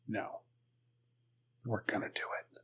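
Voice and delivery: very soft, low near-whisper; hushed resolve. Acted. The Pope insisting they do it, hushed and low. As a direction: drop to a grave hush, pausing after the first word. The Pope's grave decision, acted.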